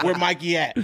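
put-on high, laughing tough-kid voice. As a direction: play a kid acting tough. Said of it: "He's like".

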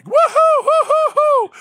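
very high, loud repeated whoops; whooping. Retold or imitated. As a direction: keep whooping for the camera. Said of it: "And I keep going".

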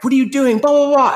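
high, loud mock scream; imitating screaming kid. Kid screaming at his teammate mid-battle, mock high yell. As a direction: shriek it like a kid losing a game. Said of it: "he just started screaming at me".

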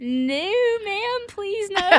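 high, whiny mock begging; playful high plea, half-laughing; mock pleading. Begging the bus lady, mock plea.

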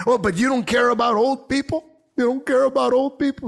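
high-pitched mocking quote of a protester; mock indignant objection. Mimics critics' indignant objection.

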